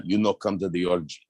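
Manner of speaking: put-on a loose take on a strange movie accent; half-hearted impression of an unplaceable accent; Russell Crowe's odd accent. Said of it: "I don't know what accent he's using".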